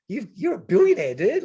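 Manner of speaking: high, loud laughing tease; laughing disbelief. Retold or imitated. Ribbing a billionaire friend on the plane, laughing loudly. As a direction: laugh it out at your rich mate.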